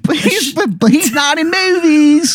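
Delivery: squeaky-high Baltimore character voice; bad Baltimore accent. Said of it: "this Baltimore accent".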